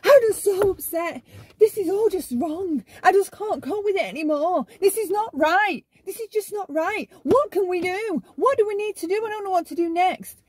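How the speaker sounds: high, loud mock wailing; histrionic upset. Shown as a demonstration. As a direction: overact being devastated, pitch way up. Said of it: "This is kinda how they do it".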